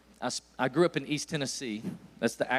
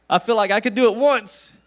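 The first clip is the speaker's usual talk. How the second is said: boastful burst; 6 semitones higher, 10 dB louder than usual. Real, not acted. Boasting louder to the crowd.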